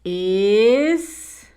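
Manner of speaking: drawn-out dictation, word by word; dictating. Shown as a demonstration. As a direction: stretch the word as you write it. Dicta mientras escribe. (dictando, alargando la palabra)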